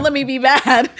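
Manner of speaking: loud, high plea breaking into laughter; laughing plea. Retold or imitated. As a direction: beg half-jokingly, cracking up.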